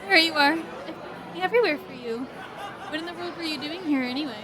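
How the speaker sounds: cheerful woman, laughing; light laugh through the words. Acted. Mel laughing, greeting.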